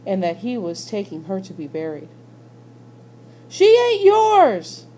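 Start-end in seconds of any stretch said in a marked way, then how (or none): 3.5-4.9 much higher, louder, slow anguished scream; boy screaming at his father over his sister's body. A narrator's reading. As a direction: scream it with everything. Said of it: "Bryce screamed".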